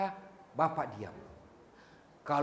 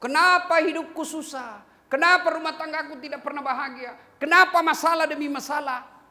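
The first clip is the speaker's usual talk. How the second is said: louder, higher lament of a troubled man; complaining believer; 7 semitones higher, 5 dB louder than usual. Retold or imitated. Troubled man complains about his hard life, louder. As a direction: cry out the complaint.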